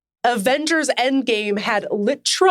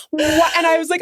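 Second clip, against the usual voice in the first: ordinary talk, nothing marked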